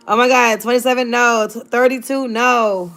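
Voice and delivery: ordinary talk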